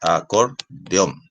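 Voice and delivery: acordeón broken into slow, separate syllables ending on -ón; slow teaching acordeón with gaps, stress on the last syllable. Shown as a demonstration. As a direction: spell out acordeón by syllables, slowly, for a class. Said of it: "Ahí la fuerza de voz está en".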